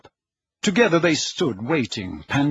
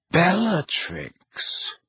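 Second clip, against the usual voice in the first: slow mocking Snape; 4 semitones higher, much slower than usual. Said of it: "slightly mocking smile".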